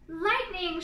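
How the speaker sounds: loud, high shriek of fright; scared turtle at the lightning flash; frightened shriek. A narrator's reading. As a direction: jolt at the flash. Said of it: "shrieked Franklin".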